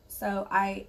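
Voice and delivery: usual speaking voice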